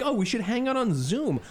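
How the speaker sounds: sneering imitation of a friend; mocking high voice.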